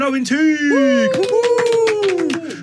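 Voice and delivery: high, whooping cheer; excited cheer. Real, not acted. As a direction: whoop it up for the guests. Radio host cheering guests in.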